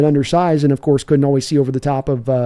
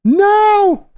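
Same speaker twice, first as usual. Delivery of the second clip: high mock cry; 4 dB louder than usual. Mock despair at aging.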